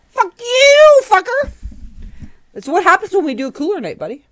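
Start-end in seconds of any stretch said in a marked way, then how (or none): 0.1-1.5 high, loud playful yell; mock outraged yell. Drunk friends teasing, mock outrage.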